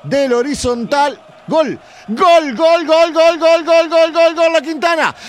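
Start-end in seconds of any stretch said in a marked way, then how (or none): 1.5-5.1 high, loud drawn-out goal cry; goal shout. Real, not acted. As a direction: scream the goal at full force. Goal scored, commentator's long shout. (grito de gol eufórico)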